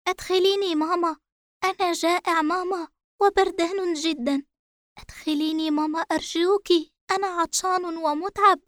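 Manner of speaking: put-on high, pleading little boy; a scared child begging his mother; frightened child voice. A narrator's reading. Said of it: "يردد بألم شديد وخوف".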